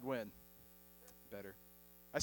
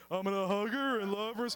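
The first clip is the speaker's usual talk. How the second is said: Lenny. Acted. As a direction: play a big, simple-minded man doting on her. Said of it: "Lenny from Mice and Men be like".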